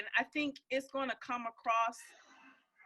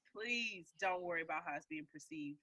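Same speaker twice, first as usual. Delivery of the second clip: lower, softer, gently pleading; softer reassurance; 7 semitones lower, 9 dB quieter than usual. Shown as a demonstration. Host gently reassures Vanessa, lower and softer. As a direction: ease her worry softly. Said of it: "So don't worry".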